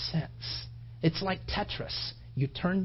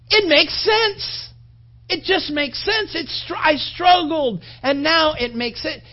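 higher and louder, fervent sermon build; preaching at full force; 7 semitones higher, 8 dB louder than usual. Acted. Pastor pressing the cross on the congregation, fervent preaching. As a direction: preach it out, pleading with the room.

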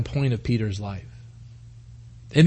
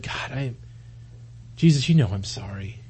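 low, halting acted apology; tearful Peter; 5 semitones lower, 6 dB quieter than usual. Peter looking at the Lord, quietly tearful. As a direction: drop low into a choked, faltering sorry. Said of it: "just tears coming down his face".

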